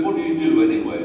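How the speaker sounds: demanding guard. Retold or imitated. Guard questions him suspiciously.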